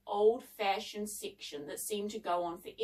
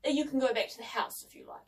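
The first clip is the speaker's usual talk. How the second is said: ordinary delivery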